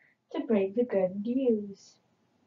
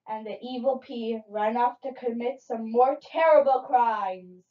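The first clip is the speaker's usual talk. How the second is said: ordinary delivery, nothing marked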